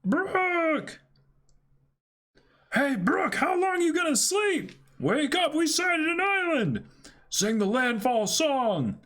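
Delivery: high excited shout. Acted. Voicing crew waking Brooke.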